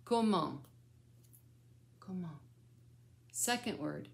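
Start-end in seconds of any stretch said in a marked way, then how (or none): 2.0-2.4 soft echo prompt. Shown as a demonstration. Grandmother feeding the child's turn, COMMENT said softly. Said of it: "then you're gonna say it".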